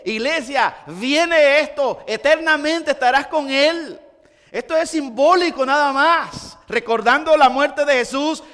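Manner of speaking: fervent preaching. Acted. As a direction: preach it with full conviction. (predicando con fervor y más fuerte)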